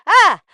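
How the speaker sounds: real shocked cry, high and loud; startled scream. Real, not acted. Vlogger seeing a post back on social media, startled scream. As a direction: let out a sudden alarmed shriek.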